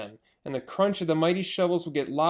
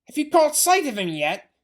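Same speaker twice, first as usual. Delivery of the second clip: higher, louder rough farmer voices; shouting farmers; 4 semitones higher, 6 dB louder than usual. Acted. Farmers calling across over engine noise, rough shout.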